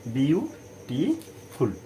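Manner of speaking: বিউটিফুল slowly as বিউ, টি, ফুল; short pauses splitting বিউটিফুল into three beats; split into syllables. Shown as a demonstration. Teacher counting three syllables, বিউটিফুল in separate slow beats. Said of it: "আমরা তিনবারই উচ্চারণ করছি".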